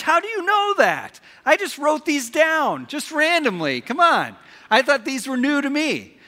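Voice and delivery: high, loud mock disbelief; loud, high-pitched feigned surprise; mock astonishment. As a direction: playfully protest as if truly caught out. Feigns surprise audience knows sayings.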